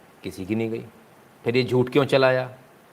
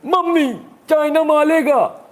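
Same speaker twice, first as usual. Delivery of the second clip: put-on much higher, louder, slow whiny child crying for mummy; hammed-up childish fright; mock scared child; 5 dB louder, slower than usual. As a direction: play a frightened little kid calling his mother. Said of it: "अब डर लग रहा है".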